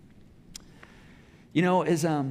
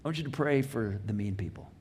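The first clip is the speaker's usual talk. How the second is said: lower voice as Jesus; 7 semitones lower, 3 dB quieter than usual. Retold or imitated. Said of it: "Jesus says".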